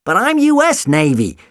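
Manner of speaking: high indignant protest. A narrator's reading. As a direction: protest it, rattled. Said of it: "he protested".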